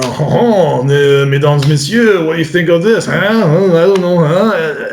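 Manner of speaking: put-on swooping, showy French accent; French-accented patter with exclaimed ohs and huhs; in-your-face French. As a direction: play a pushy French magician. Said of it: "The French are sort of in your face".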